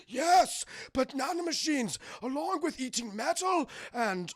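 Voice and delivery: high, hammy villain reveal; the Doctor. Retold or imitated. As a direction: announce it with mad-scientist relish. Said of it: "he talks like this throughout the entire movie".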